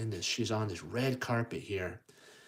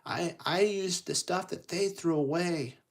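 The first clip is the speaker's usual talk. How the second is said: high-voiced heroine pouring out her woes; upset young storybook heroine, pitched up; Cinderella; 5 semitones higher than usual. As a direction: plead your case in a higher voice. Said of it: "she, Cinderella goes".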